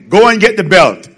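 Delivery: loud, high mock-mother order; stern mom demanding the belt; mother's command. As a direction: shout it like an angry mom. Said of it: "When your mother say".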